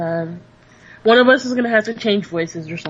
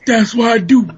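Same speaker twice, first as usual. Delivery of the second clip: man voice; 5 dB louder than usual. Shown as a demonstration. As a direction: sound like a big gruff man. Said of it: "Why do you sound like a man".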